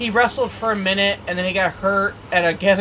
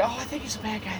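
hushed, much softer murmur; imitated spectators' aside; 12 dB quieter, faster than usual. Confused family talking to each other about the wrestler, hushed aside. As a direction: play onlookers quietly conferring. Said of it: "they're talking to each other".

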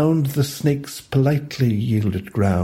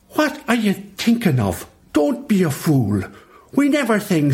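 cross; 9 semitones higher than usual. A narrator's reading. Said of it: "terribly cross".

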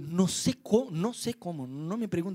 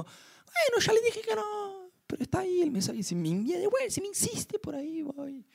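much higher, slow whiny excuse; mock weak resolve; 11 semitones higher, 3 dB quieter than usual. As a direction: whine the excuse, fading out. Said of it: "No el slow motion como". (excusa quejosa, mucho más aguda y lenta)

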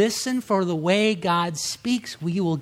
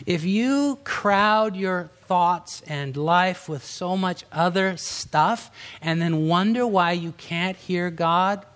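usual speaking voice

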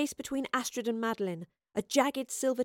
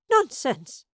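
higher, louder alarmed denial; fearful; 4 semitones higher, 6 dB louder than usual. A narrator's reading. Professor rejecting Yarrow's accusation, afraid. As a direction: dismiss it with a touch of fear. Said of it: "the professor replied, her voice a-feared".

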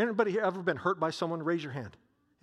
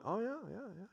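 bored crowd shrug; 4 semitones higher, 10 dB quieter, much faster than usual. Retold or imitated. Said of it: "people went".

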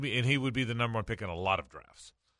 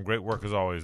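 ordinary talk

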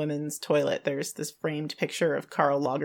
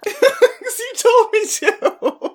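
high, slow laughing reply; 7 dB louder, much slower than usual. Real, not acted. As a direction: giggle through the words. Laughing reply after the noise.

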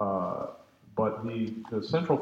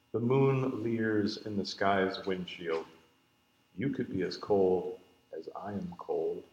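poetry reading; 5 dB louder than usual. Acted. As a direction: read the poem out to the room in measured, emphatic lines. Reads a poem aloud.

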